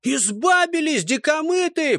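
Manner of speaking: high, sustained taunting yell; jeering shout from afar. A narrator's reading. Enemy on the heights taunts the squad, jeering shout. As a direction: hurl the taunt down from above. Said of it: "полетело сверху".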